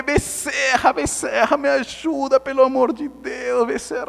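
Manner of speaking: high-pitched imploring cries; comic frantic supplication; mock desperate plea. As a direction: beg a saint for rescue, hammed up. Retold plea to spirit while drowning.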